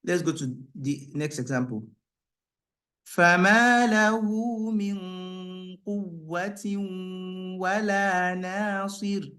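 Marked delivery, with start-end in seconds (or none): 3.1-9.3 chanted, higher recitation; Quran recitation. Acted. Teacher chanting verses for the class.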